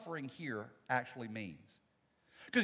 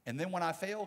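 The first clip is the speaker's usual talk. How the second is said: ordinary talk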